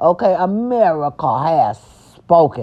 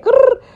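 playful growl; 6 dB louder than usual. Pretend. Growl sound.

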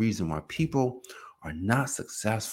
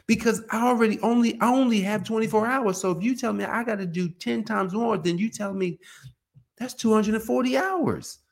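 complaining body; 12 semitones higher than usual. Retold or imitated. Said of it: "The body's gonna say".

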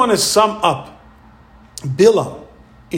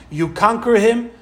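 normal talking voice

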